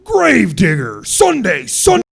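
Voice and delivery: very high, loud, slow announcer yell; monster truck ad. Acted. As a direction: hype it like a rally promo. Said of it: "radio promoting a monster truck rally voice".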